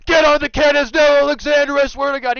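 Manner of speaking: high, very loud mock barking down the mic; barking screamer. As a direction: bellow orders like an enraged gamer. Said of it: "he's, like, barking down the microphone".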